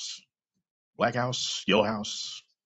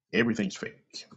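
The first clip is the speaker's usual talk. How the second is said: normal talking voice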